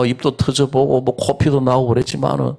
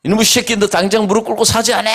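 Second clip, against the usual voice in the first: high, fast scolding yell at son; barking at the boy to kneel, hammed up; mock angry yell; 10 semitones higher, faster than usual. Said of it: "하고 소리를 꽥 지르니까". (아들에게 무릎 꿇으라고 호통치는 재연 고함)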